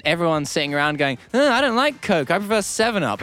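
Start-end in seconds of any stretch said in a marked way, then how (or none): 1.3-3.2 high whiny objection; mock fussy complainer. Comedian voicing picky voters refusing Coke, high mock complaint. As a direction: imitate a picky refuser, pitched way up.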